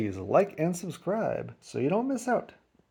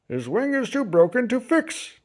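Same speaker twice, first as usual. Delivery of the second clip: vet's voice; 7 semitones higher than usual. A narrator's reading. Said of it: "the doc said".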